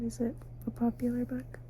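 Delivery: ordinary delivery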